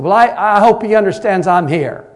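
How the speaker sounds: loud, high demanding call; impatient demand. Retold or imitated. Impatient teenage girl demands to see the pastor, loud. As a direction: demand it across the office, fed up. Teen complains to secretary.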